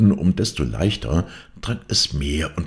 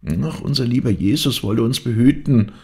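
high, excited maid's voice; agitated exclamation; 9 semitones higher, 4 dB louder than usual. A narrator's reading. Angrete breathlessly brings bad news to the maids, high exclamation. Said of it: "rief Angrete".

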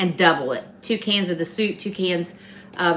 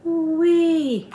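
delighted; 7 semitones higher than usual. Real, not acted. Tasting the finished dish.

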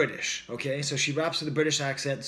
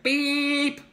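long, high held electronic-style beep; intense beep imitation; 12 semitones higher, 4 dB louder than usual. Critic showing a track's disruptive sound, intense beep. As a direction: imitate a piercing beep on one high note. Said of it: "it has this really intense".

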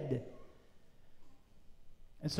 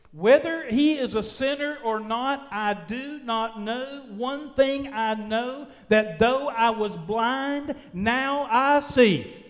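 full-force preaching; 2 semitones higher, 4 dB louder than usual. Acted. Healed man answering his accusers, preached at full force. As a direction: preach it out, stretching the key words.

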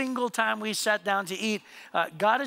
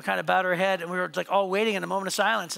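ordinary delivery

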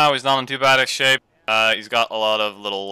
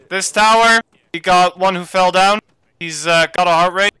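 urgent report; 7 semitones higher, 7 dB louder than usual. Real, not acted. Reporting the fallen teammate urgently.

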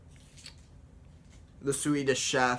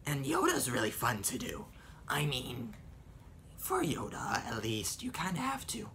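airy, half-lost voice; breathy Yoda whisper; 8 dB quieter than usual. Shown as a demonstration. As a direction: talk through a breathy whisper.

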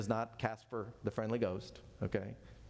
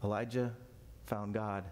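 usual speaking voice

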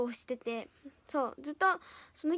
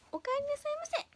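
sugary maid cafe greeting; 10 semitones higher, 4 dB quieter than usual. Acted. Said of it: "めっちゃ暗い。".

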